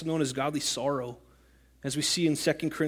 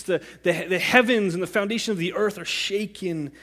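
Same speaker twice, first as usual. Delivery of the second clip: normal talking voice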